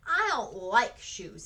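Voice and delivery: put-on loud, very drawn-out childish complaint; complaining. A narrator's reading. Petey objecting to shoes, loud slow complaint. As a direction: grumble it slowly, leaning hard on the words. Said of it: "he complained".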